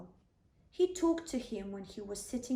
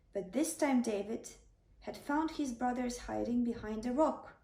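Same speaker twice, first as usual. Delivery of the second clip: ordinary delivery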